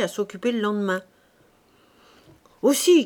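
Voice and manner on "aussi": a sharp, loud opening burst; angry shout. A narrator's reading. Chanteau shouting at the fisherman, annoyed: loud angry outburst. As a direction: blurt it out loud in irritation. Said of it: "cria-t-il".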